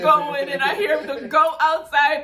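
giggling through the words; laughing while talking. Real, not acted. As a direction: laugh as you tell it.

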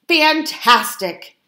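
slow, high, overdone cheer; fibbing. A narrator's reading. Foxes fib to Fosdyke, slow and fake-cheerful. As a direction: sell the lie with a big fake smile. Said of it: "they fibbed".

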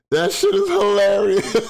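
high and giggly; squeezed out between laughs; real laughter; laughing. Real, not acted. Laughing hard at clip.

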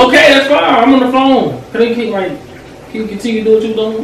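loud, high, fed-up brush-off; curt annoyance. Acted. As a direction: get her out of your business. Telling partner to leave him.

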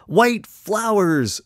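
high, slow, delighted clown cry; happy scream. A narrator's reading. Happy clown screaming the new chant, high delighted cry. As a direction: stretch it out in pure delight. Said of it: "screamed the happy clown".

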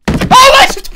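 sudden high shriek at a jump scare; startled scream. Real, not acted. Gamer startled by a jump scare, screaming. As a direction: jolt and yelp, truly scared. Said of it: "That scared me, bro".

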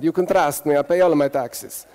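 lightly put-on Italian English; Italian accent. Shown as a demonstration. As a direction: play the shady Italian lightly. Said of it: "with that accent".

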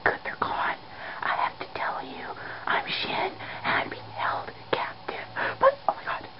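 hushed, breath-only plea for help; secret whisper. Acted. Secretly confesses being held captive.

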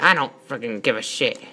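low, soft, offhand comeback; quiet acted indifference; dismissive retort. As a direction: brush it off under your voice. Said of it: "And I'm like".

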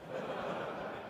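a quick breathy laugh at the punchline; soft amused chuckle. Real, not acted. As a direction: laugh under your breath. Teller laughs at own joke.

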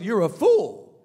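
sudden loud condemnation; loud rebuke. Acted. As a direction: deliver the verdict with a punch. God's rebuke acted again.

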